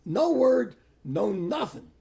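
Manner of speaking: high, loud disbelief on the first words; exclaimed indignant surprise, dropping after the first burst; retold astonishment. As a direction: recount it as utterly dumbfounded. Relives shock at surprise appointment.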